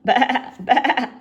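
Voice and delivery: loud bleating bah; lamb bleat. A narrator's reading. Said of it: "says the lamb".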